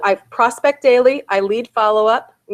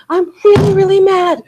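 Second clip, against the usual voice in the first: put-on higher, louder whiny kid protest; mock mad kid; 10 semitones higher, 7 dB louder than usual. Mom retelling her child's tantrum to the host, mock angry kid voice. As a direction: play the sulky child insisting he's mad. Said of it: "we taught our kids to do this".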